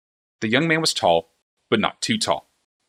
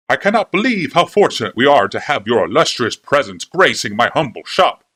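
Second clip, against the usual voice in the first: higher, louder gushing shopkeeper; fawning clockmaker; 4 semitones higher, 6 dB louder than usual. A narrator's reading. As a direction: gush over the princess like an eager flatterer. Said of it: "he murmured in a rapid cadence".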